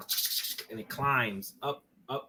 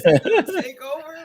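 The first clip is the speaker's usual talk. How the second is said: laughing; 13 dB louder, much slower than usual. Real, not acted. Laughing, hammed up.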